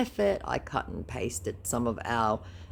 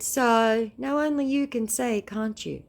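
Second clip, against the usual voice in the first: sarcastic high mocking; 9 semitones higher than usual. Mocking the scammer's reply.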